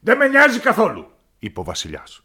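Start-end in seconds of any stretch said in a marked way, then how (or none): 0.0-1.1 high, loud royal tantrum; a spoiled king shouting his complaint; petulant shouting king; angry king. Acted. King refuses the minister.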